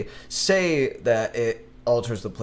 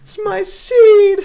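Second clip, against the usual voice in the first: very high, loud squeaky critter voice breaking into laughs; tiny outraged gremlin, slow and cracking up; tree dude voice; 7 dB louder, slower than usual. Retold or imitated. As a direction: possessive creature squeal through laughter. Acting the tree dude again.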